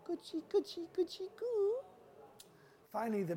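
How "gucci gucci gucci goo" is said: very high playful coo; comforting baby talk. Acted. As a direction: coo to distract a crying baby. Chief soothing crying baby.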